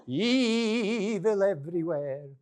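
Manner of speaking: put-on slow, sing-song storytelling of an old grandmother; lightly voiced grandma, slow and lilting; lyrical grandma. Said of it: "That's the way she talks".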